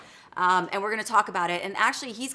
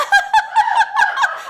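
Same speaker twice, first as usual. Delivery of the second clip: high loud burst of laughter; embarrassed laugh; 11 dB louder than usual. Real, not acted. Just ate lunch live on TV.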